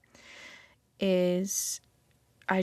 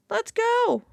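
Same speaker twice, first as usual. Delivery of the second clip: excited; 13 semitones higher, 4 dB louder than usual. Real, not acted. Cheering herself on.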